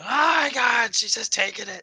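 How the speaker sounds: hammed-up disbelief at the video; mock horrified. Imitating her own horrified reaction.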